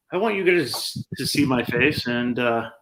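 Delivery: normal talking voice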